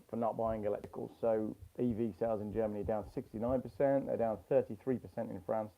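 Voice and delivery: dull, muffled mic sound; like talking through a cloth; muffled audio. Real, not acted. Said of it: "Very muffled, very muffled".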